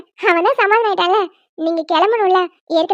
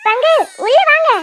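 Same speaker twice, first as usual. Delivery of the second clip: higher-pitched call to come in; 4 semitones higher than usual. Acted. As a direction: summon someone from outside. Calling father in from outside.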